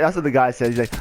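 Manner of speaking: usual speaking voice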